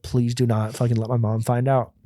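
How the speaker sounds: usual speaking voice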